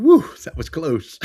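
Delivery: high, loud relieved whoo; relieved inner voice. Retold or imitated. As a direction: let out the near-miss relief. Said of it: "in the back of my head, I'm going".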